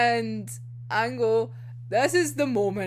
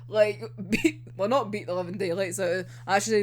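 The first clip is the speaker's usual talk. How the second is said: ordinary talk, nothing marked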